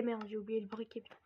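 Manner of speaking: hushed annoyed whisper under his breath; whispered self-scolding; whispered aside. Real, not acted. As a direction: mutter it off-mic, barely voiced. Realises he forgot the lighter.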